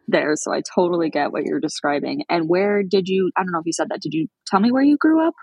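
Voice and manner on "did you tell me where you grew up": usual speaking voice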